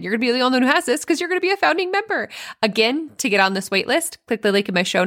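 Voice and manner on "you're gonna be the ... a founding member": fast, rising excited rush; excited hype. Real, not acted. Coach pitching founding membership to moms, excited. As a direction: race upward through the perk in one breath.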